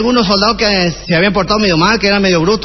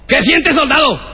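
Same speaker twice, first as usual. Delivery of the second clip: questioning the recruit sharply, pitched up; barking sergeant; 6 semitones higher, slower than usual. Acted. Sergeant to recruit at drill, a raised barking demand.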